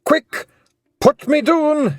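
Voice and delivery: high, loud Pixie yell; urgent, panicked Scottish yell. A narrator's reading. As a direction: yell it in a hurry. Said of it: "he yelled".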